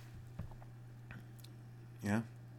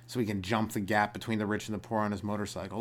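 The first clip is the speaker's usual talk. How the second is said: ordinary talk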